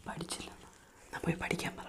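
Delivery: hushed, voiceless prompt; secretive whisper. Shown as a demonstration. Parent prompts child to ask secretly, whispering. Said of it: "പതുക്കെ പറ".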